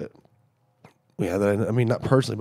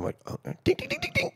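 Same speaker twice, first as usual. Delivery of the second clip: ordinary delivery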